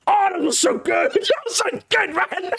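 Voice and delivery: high, cracking-up squeal of delight; gleeful nostalgia. Real, not acted. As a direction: gush as laughter takes over. Gushing over remembered trash talk.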